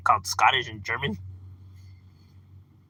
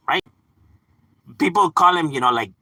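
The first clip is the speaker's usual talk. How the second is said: ordinary talk, nothing marked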